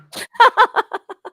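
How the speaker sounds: high, loud burst of laughter. Real, not acted. High burst of laughter at the dog staring at the wall. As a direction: crack up at the dog.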